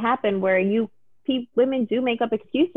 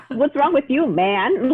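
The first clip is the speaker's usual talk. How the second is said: laughing; slower than usual. Retold or imitated. Laughing while quoting him.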